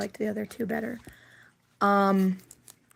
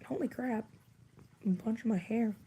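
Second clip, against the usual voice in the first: usual speaking voice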